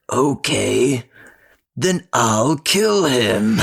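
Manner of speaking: slow, drawn-out imitation of a demon; mock demon voice. Son answering his own prayer in a mock demon voice. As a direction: stretch it out like a little demon. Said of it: "a demon".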